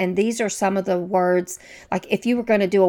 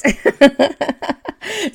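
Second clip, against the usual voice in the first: laugh; 6 semitones higher, 4 dB louder than usual. Real, not acted. Laughing at own mispronunciation.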